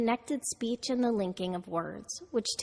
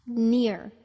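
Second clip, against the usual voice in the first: near said louder and drawn out; stressed syllable; 2 semitones higher, 6 dB louder than usual. Shown as a demonstration. Teacher isolating the end of engineer, strongly stressed. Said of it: "the third syllable is stressed".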